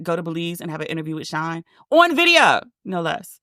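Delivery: ordinary delivery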